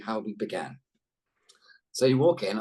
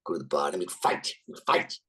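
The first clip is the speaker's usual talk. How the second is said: Tony; 10 semitones lower than usual. Retold or imitated. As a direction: imitate an elder actor recalling old exploits, very low. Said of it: "He's like".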